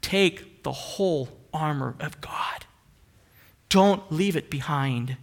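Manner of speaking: a hint of a slow hushed divine command; lightly voiced, hushed divine command; voice of God. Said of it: "God says".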